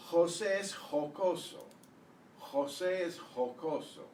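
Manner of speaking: "José es jocoso" as kho-SEH es kho-KO-so; exaggerated Spanish pronunciation. Shown as a demonstration. Teacher modelling the phrase "José es jocoso", throaty Spanish Js. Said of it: "trying to exaggerate a little bit".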